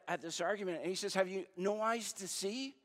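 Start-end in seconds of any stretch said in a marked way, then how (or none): 1.1-2.7 raised pitch, exasperated questioning; frustrated. Acted. Jesus to his arguing disciples, frustrated. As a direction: press each question with mounting exasperation. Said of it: "He gets frustrated at".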